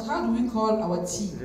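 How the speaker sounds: ordinary talk, nothing marked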